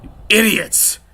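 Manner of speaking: high, loud furious yell; shouted high and loud with rage. Acted. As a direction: blow up at the two idiots. Gang leader explodes at his men.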